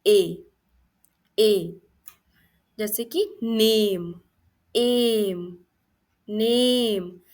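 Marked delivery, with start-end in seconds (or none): 0.1-1.8 isolated ए, firm and louder; phonics demonstration. Shown as a demonstration. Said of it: "ए का third phonic sound है". 3.4-7.2 नेम with a long held "ay"; sounding out ए. Shown as a demonstration. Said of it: "इसमें ए का sound आ रहा है".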